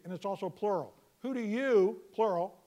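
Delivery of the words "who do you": "you" punched high and loud; stress on you. Shown as a demonstration. Preacher showing the Greek emphasis, punching "you". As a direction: hit the word "you" hard. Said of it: "you" is in an emphatic position".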